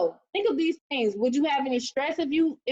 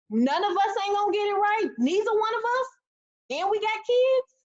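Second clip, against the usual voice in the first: exasperated; 8 semitones higher than usual. Real, not acted. Frustrated about being broke.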